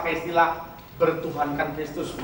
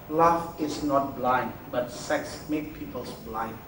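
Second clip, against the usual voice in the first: slow emphatic; 6 semitones lower, 5 dB quieter, much slower than usual. Real, not acted. Key sermon line, slowed.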